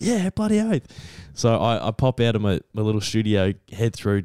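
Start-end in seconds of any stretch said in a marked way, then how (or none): 0.0-0.9 high-pitched, gung-ho agreement; eager footballer impression. Footballer told he'll be put on with Dad, eager. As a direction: agree like a pumped-up mate.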